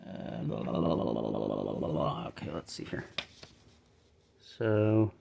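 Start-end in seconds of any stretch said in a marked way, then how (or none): 0.1-2.2 low, rolling tongue-wagging babble; idle blah-blah babble. Real, not acted. Puzzling aloud over where the parts go, idle babble. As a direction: babble a low, rapid string of blahs.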